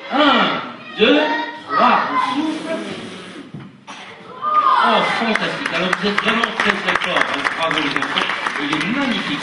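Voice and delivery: loud, high cheering countdown; rally the kids to blow; kids show host. Acted.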